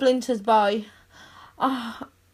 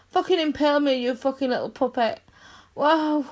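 usual speaking voice

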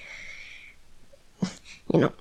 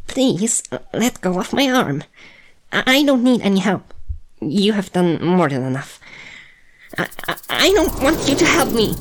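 panicked; 3 semitones higher, 4 dB louder than usual. Acted. Panicking, pulling away from human.

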